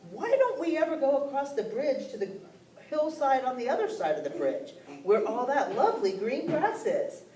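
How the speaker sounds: high, small billy goat voice; little goat voice. Acted. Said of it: "the littlest billy goat said".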